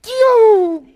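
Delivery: sudden high loud Yo; excited shout. Real, not acted. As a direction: burst out in joy. Excited outburst in sermon.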